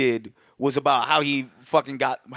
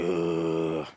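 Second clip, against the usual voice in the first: low grunt; 10 semitones lower, 5 dB quieter than usual. Retold or imitated. Monster grunt.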